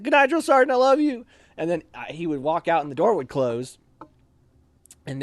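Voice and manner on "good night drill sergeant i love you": very high, loud sweet-talking call; sing-song goodnight. Retold or imitated. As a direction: tease him with affection. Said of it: "I'd like".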